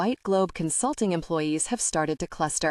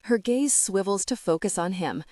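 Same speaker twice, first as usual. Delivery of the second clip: ordinary delivery, nothing marked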